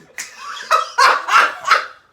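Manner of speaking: very loud, high shrieking laugh; uproarious laughter. Real, not acted. Co-host's doctor joke lands, exploding with laughter.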